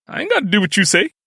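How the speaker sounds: defiant. A narrator's reading. Brother refuses his bossy sister, defiant. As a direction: talk back like a stubborn kid.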